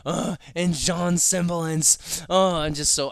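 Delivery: overwhelmed with happiness; overjoyed. Real, not acted. Overwhelmed excited rush.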